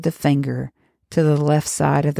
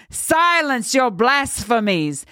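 priest's rebuke; 9 semitones higher, slower than usual. A narrator's reading.

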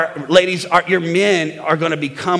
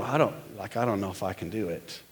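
much lower and far softer, giving up; defeated mumble; 9 semitones lower, 14 dB quieter than usual. Retold or imitated. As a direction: sink into a deflated mutter. Defeated man voiced.

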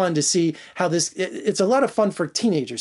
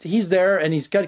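ordinary talk

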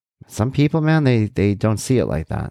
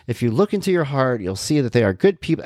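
lightly imitated, higher-pitched earnest reassurance; mock diplomatic small talk, higher; Jason Bateman; 7 semitones higher than usual. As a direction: politely keep arguing, pitched up. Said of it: "Jason Bateman goes".

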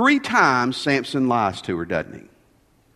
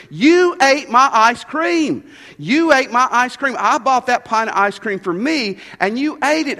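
furious wife; 8 semitones higher, 4 dB louder than usual. Retold or imitated. Wife accuses husband of eating her ice cream, furious and loud. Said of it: "she was furious at me".

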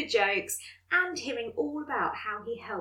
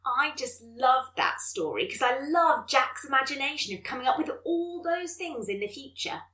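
usual speaking voice

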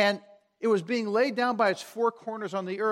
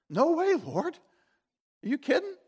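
high-pitched mock disbelief; incredulous refusal; 7 semitones higher than usual. Believer's first reaction when God speaks, incredulous refusal. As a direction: balk at it, voice jumping up. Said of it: "our first reaction is".

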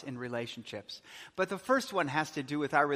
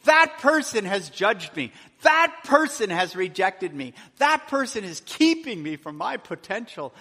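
much louder, higher, piling up grievances; aggrieved complaint; 5 semitones higher, 9 dB louder than usual. Retold or imitated. A hurt listener listing grievances, loud and aggrieved. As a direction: vent every hurt like it's unfair. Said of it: "How do I do this when it's so difficult?".

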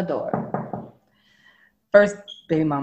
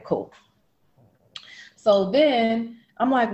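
normal talking voice

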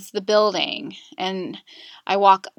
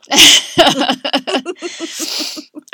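real, loud laugh through tears; embarrassed laugh while crying; tearful laugh; 6 semitones higher, 8 dB louder than usual. Real, not acted. Tearful laugh after breaking down.

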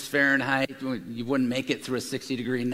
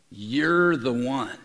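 You're the one drawn out slowly; slow, weighty repeat; 2 semitones lower, much slower than usual. Acted. Peter telling Jesus slowly he alone is the one. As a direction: let it land slowly.

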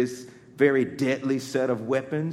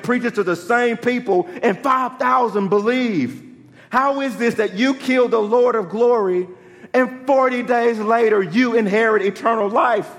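rising into full-force preaching, louder and higher; building preaching; 10 semitones higher, 8 dB louder than usual. Acted. Preacher tells congregation of the crucifixion, building preaching.

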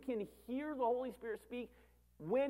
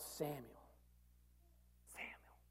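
much softer, breathy whispered call of a name; 6 semitones lower, 16 dB quieter, slower than usual. Acted. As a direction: call the name so softly it is almost lost. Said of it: "If they said it that softly".

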